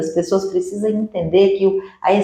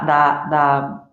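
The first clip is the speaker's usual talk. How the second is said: ordinary talk, nothing marked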